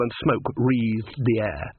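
usual speaking voice

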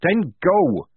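loud, high angry command; shout. A narrator's reading. Polly telling Tonka to go, shouting. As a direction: shout it as a dismissal. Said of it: "shouted Polly".

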